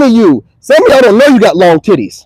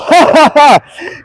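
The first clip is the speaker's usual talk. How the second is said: higher-pitched put-on ha-ha laugh; mock chuckle; 5 semitones higher, much slower than usual. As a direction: let out a staged, taunting chuckle. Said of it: "gonna get a good chuckle".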